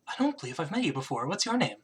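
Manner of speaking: soft-spoken queen; queen character. Acted. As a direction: quietly question a stranger as the queen. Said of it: "looks at you and she goes".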